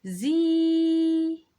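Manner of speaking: زي held long, high and loud; long vowel. Shown as a demonstration. Teacher showing kids the long vowel in زي. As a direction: draw out زي as a long ee. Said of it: "أما المد أطول شوية".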